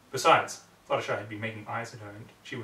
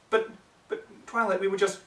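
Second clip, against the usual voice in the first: higher, pleading pony voice; hurt protest; 8 semitones higher, 5 dB louder than usual. Acted. The friend pleading with Twilight, higher. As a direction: falter as you plead.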